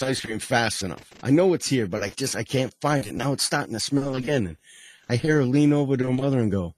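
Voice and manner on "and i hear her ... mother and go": normal talking voice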